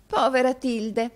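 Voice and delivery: high, slow, loud show of pity; drawn-out, high-pitched commiseration; sighing commiseration. A narrator's reading. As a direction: sigh with pity for a poor friend. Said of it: "sospirò la signorina Squeers con commiserazione".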